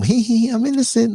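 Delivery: high, sing-song fake innocence; mock innocent. Backstabbers pretending innocence, high sing-song voice. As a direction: giggle it up high like a sly innocent. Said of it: "little hidden messages and come".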